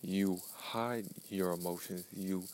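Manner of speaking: normal talking voice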